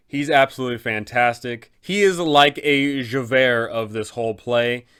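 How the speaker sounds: normal talking voice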